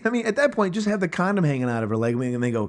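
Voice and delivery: normal talking voice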